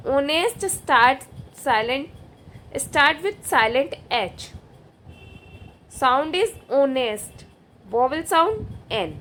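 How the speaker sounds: very slow, spelled-out dictation pace. Shown as a demonstration. Teacher reading slowly for students to write down. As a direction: read it out slowly so students can copy. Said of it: "देखिए अब इसको देख लेते हैं explanation".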